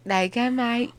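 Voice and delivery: playful, badly faked Australian; Australian accent attempt. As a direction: try a broad Australian accent. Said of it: "when you do an Australian accent".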